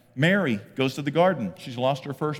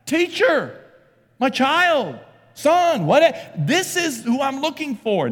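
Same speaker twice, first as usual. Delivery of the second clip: high, loud cries of recognition; crying out; 9 dB louder, slower than usual. Acted. Mary recognizing her risen son, crying out. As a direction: cry out in separate bursts, astonished. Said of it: "cries out in Aramaic".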